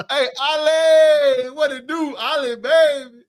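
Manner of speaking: loud, drawn-out greeting call. Real, not acted. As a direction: shout the greeting slowly. Calling out a greeting to Ali.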